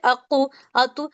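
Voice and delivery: अकु, अतु with a short half-u echo after the consonant; slow, with a half vowel released after क and त; half pesh bounce. Shown as a demonstration. Teacher shows students the half-pesh qalqala sound, slowly. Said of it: "आधे पेश की आवाज है इसमें".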